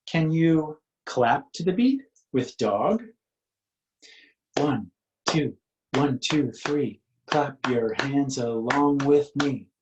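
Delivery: ordinary delivery, nothing marked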